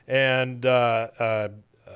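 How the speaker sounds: ordinary delivery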